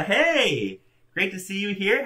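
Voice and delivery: ordinary delivery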